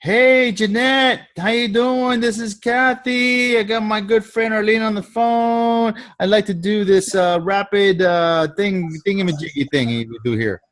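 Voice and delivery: high, friendly and slow; chirpy three-way call greeting; bubbly caller. Shown as a demonstration. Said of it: "And you'll be like".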